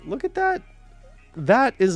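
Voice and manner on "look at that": ordinary talk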